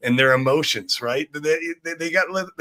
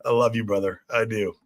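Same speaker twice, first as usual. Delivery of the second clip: ordinary talk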